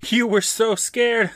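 teasing gloat. Retold or imitated. Steve teasing Chuck after the scare, high and mocking. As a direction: rub it in playfully.

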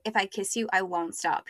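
ordinary delivery

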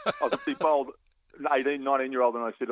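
ordinary talk

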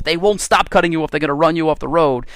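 ordinary talk, nothing marked

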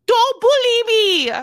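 put-on high, loud Irish-accented yell; sudden Irish accent. Host protesting to co-host's judgment, Irish-accented yell. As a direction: cry out in an Irish accent, stretched out. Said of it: "went into an Irish accent so quick".

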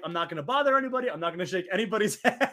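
fast, eager self-talk, laughing at the end; rattling off a nervous resolution; rushed nervous resolve. Retold or imitated. Telling the host how he entered the Saudi show, fast and eager. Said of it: "being like".

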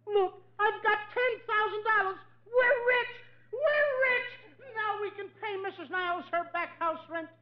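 high, laughing with joy; overjoyed. Acted. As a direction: crow with delight. Overjoyed at getting money.

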